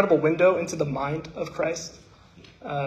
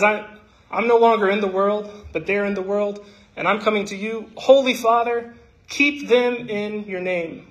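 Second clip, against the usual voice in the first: raised, earnest recital of Jesus' prayer; scripture recitation; 4 semitones higher than usual. Acted.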